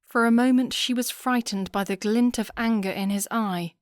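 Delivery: plain reading voice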